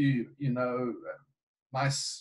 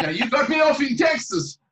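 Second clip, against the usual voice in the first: high, louder, laughing reply; 8 semitones higher, 3 dB louder than usual. Real, not acted. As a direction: still laughing from the punchline. Laughing after the joke.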